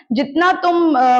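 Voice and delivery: usual speaking voice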